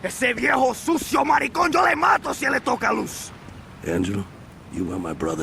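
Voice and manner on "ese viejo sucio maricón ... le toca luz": furious shout. Acted. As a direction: yell the threat with fury. Jealous rage about his sister.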